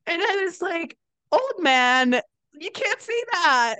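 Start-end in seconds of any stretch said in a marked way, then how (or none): 1.3-3.8 high, drawn-out playful reprimand; mock scolding. Stripper telling off her elderly regular, mock scandalised. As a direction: scold him in scandalised disbelief.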